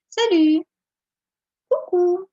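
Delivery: Salut and Coucou called out high and loud; cheerful teacher demo of friendly greetings; casual friendly greeting. Shown as a demonstration. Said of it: "це таке більше між друзями".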